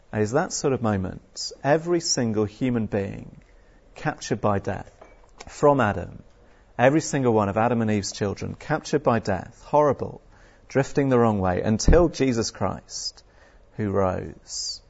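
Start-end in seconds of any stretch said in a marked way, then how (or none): none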